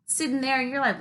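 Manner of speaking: ordinary talk, nothing marked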